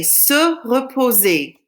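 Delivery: slow careful French model; slow model for repeating. Shown as a demonstration. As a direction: say se reposer slowly and clearly. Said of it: "Say it after me".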